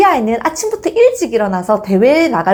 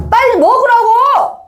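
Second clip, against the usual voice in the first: high, louder acted outburst; exasperated kid yelling, pitch shooting up; angry brother's yell; 13 semitones higher, 4 dB louder, slower than usual. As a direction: yell at a dawdling little brother. Older brother yelling at younger.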